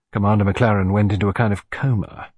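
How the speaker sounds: plain reading voice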